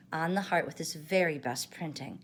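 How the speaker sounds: ordinary delivery, nothing marked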